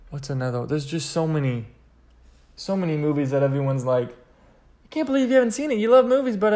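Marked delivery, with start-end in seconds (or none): 4.1-6.5 mock disbelief. Mocking friends' disbelief.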